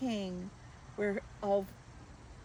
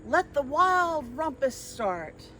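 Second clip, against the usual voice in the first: higher, louder gleeful cry; cried out; 7 semitones higher, 8 dB louder, slower than usual. Acted. Max starting the rumpus, an excited cry. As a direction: call the party to begin. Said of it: "cried Max".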